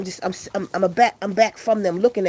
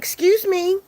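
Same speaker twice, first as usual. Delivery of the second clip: high, polite stop call; mock uptight lady; prim waitress; 10 semitones higher, 4 dB louder, slower than usual. Waitress stops him at door.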